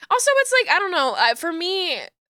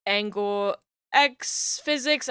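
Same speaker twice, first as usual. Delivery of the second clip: ordinary talk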